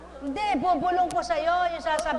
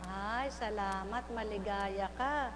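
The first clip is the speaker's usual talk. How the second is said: 1 soft thanks; 6 semitones lower, 10 dB quieter, slower than usual. Real, not acted. Tender thanks to woman.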